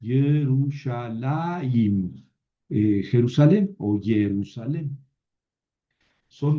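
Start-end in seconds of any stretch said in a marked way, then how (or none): 0.0-2.2 Yerushalayim very slow, the i sounded before the m; careful pronunciation. Shown as a demonstration. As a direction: model Yerushalayim syllable by syllable. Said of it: "pero se pronuncia antes". (pronunciación lenta: Ye-ru-sha-la-yim)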